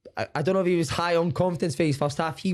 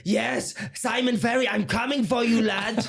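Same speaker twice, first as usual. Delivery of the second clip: a hint of a wrestling promo, pitched up and pushy; 7 semitones higher, slower than usual. Ex-player retelling his old teammate's interview threat, mock wrestling promo. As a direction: call out your old teammate like a ring challenge. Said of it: "Like a wrestling promo?".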